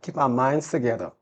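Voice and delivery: ordinary talk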